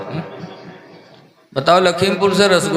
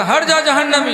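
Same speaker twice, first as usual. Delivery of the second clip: high, dismissive push-away; contemptuous rebuff; 9 semitones higher than usual. Acted. Zubaida half asleep brushing off Harun, high and scornful.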